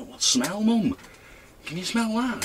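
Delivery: high-pitched retold question; mock high voice. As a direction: pitch it way up like a curious kid. Retelling talk with mum.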